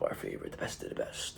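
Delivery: a secret compliment for the favorites; hushed, breathy praise; whispered aside. Real, not acted. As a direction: whisper it fondly, under the breath. Fond aside to favorite clients.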